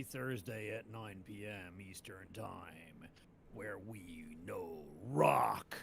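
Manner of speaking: whispered like a dramatic tagline; breathy slogan whisper. Pretend. Host whispering the stream's slogan to viewers. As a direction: whisper the show's motto for effect.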